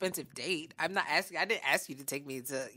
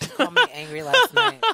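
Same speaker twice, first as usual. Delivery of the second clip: laughing; 10 dB louder than usual. Real, not acted. Laughing accusation at the club.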